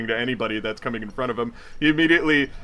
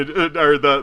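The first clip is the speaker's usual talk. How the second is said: ordinary delivery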